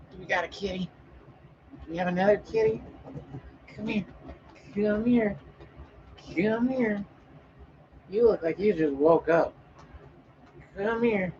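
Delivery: high cooing voice calling the kitty. Real, not acted. Calling the sleepy cat over to join, high cooing baby talk.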